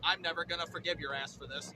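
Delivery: low, quiet grudge from a cat; resentful cat. Acted. Caged cat to owner, low resentment. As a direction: seethe lowly as a glaring cat. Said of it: "Tiffany's glaring at me through her cage".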